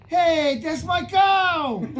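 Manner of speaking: high, loud reenacted holler; indignant shout. Storyteller reenacts catching the creature at his cow, shouting indignantly. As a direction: yell it at a cattle thief.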